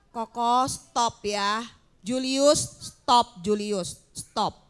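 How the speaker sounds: ordinary talk, nothing marked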